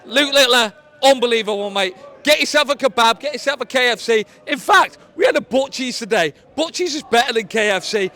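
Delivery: loud, excited fan yelling; excited shouting. Real, not acted. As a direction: shout it over the crowd. Drunk fan shouting a message.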